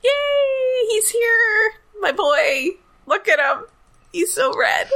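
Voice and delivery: high, gushing delight; real high-pitched fangirl cheer; excited fan squeal. Real, not acted. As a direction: squeal over your favorite arriving. Fan greets a favorite character.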